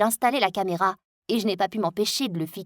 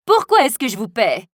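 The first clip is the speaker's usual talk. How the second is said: louder, exasperated demand; annoyed scolding; 6 dB louder than usual. Acted. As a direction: berate the tutor. Mother scolds the tutor.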